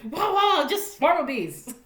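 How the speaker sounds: high mock amazement, slowing at the end; sarcastic wow. Real, not acted. Mocking the movie's bee attack, sarcastic awe. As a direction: sell the fake awe. It's like.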